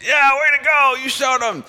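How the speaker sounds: high, loud whoop of approval; mock cheering. Friends in the car cheering the curser, loud high whoop. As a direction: cheer him on like a rowdy buddy.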